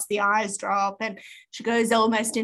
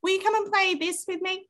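put-on high little-kid plea; child voice begging her sister to play; imitating a pleading child; 9 semitones higher than usual. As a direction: ask like a begging seven-year-old. Child pestering sister again.

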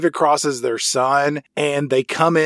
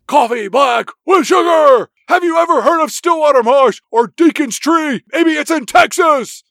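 mock Nicolas Cage; 11 semitones higher, 5 dB louder than usual. Nicolas Cage orders coffee and asks the waitress about a prison, shouting high. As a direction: overact a manic shouting customer. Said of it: "he says".

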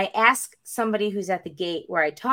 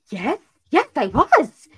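high, punchy yes, getting louder; eager answer; 5 semitones higher, 7 dB louder, slower than usual. Retold or imitated. Traveler answers man who found Robert, eager. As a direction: perk right up with sudden hope.

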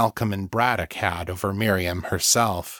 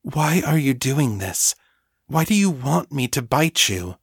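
higher, mildly baffled questioning; bewildered; 5 semitones higher than usual. A narrator's reading. Mistress questioning her willing thrall, mildly bewildered. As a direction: ask with understated bewilderment.

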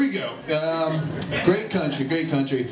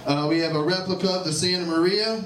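ordinary talk

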